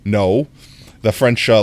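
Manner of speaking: ordinary delivery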